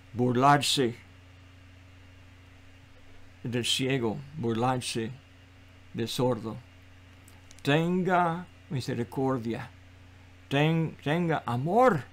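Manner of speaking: low, soft, solemn appeal with long pauses; hushed, earnest pastoral appeal; solemn plea. Real, not acted. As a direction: plead softly and gravely for mercy. Solemn plea after rebuking cruel mockery.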